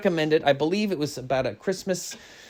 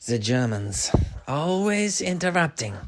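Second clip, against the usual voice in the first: put-on German accent; 3 dB quieter than usual. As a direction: play a grumpy German in a thick German accent. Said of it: "was that accent German there?".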